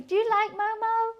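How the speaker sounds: high pet-talk voice; cooing to kitten. Real, not acted. Talking to the new kitten.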